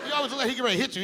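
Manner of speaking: ordinary talk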